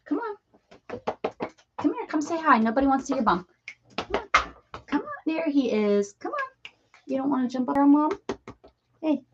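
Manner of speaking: sing-song coaxing to come over; pet talk. Real, not acted. Coaxing the cat to the camera.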